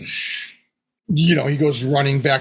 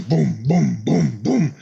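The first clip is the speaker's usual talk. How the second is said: booming footsteps; slower than usual. Retold or imitated. Imitating monster footsteps.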